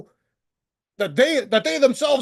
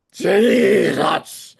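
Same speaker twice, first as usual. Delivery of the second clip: loud, exasperated "Jesus!"; exasperated outburst; 6 dB louder than usual. Real, not acted. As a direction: blurt it out in frustration. Exasperated outburst mid-rant.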